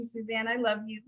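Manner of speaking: normal talking voice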